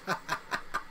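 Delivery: soft breathy chuckle in quick bursts; brief quiet laugh, mostly breath; short chuckle. Real, not acted. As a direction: chuckle softly at his own remark. Amused by funny Canadian videos.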